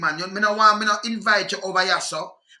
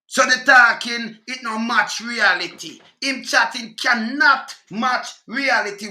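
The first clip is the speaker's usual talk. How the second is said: slow, louder deliberate words; slow emphatic; 4 semitones higher, 5 dB louder, slower than usual. Real, not acted. Ranting man insists the talk does not match reality, slow and loud. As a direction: hammer each word home.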